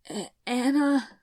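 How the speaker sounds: a wounded woman's pained cry; pained call. A narrator's reading. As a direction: call out through the pain. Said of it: "Isabel's pained voice called out".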